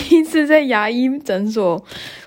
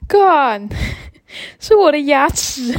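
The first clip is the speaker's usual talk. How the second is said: a sudden higher, laughing cry of disbelief; shocked realization; 5 semitones higher, slower than usual. Real, not acted. Realizing the lost thing is her own tooth, shocked laugh. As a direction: blurt it out half laughing in shock.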